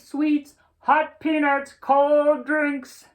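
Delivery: high, loud vendor's call; stadium hawker calling. Acted. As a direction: cry out your wares to the crowd. Hawkers selling in the stands.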